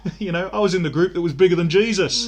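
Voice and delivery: lightly faked Liverpool twang, full of himself; terrible Liverpudlian accent. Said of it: "That's a terrible Liverpudlian accent".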